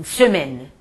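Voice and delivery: semaine ending on the N, final e silent; French semaine. Shown as a demonstration. Teacher showing students that the final e in semaine is silent. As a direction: say semaine, stop on the N. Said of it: "termina com ezinho".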